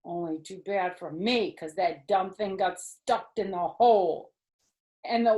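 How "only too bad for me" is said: ordinary delivery, nothing marked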